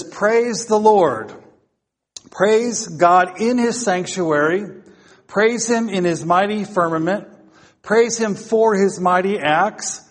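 high, rhythmic litany with measured pauses; declaimed scripture reading. Real, not acted. Preacher reading the last psalm to congregation, declaimed. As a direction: proclaim each line as a call to praise.

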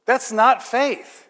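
usual speaking voice

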